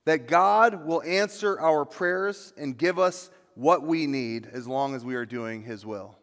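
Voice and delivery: ordinary talk, nothing marked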